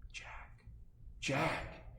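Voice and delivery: tiny, very soft whispery voice; hushed little figure; whispering. Acted. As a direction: whisper it into the giant's ear. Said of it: "your figure is whispering in your ear".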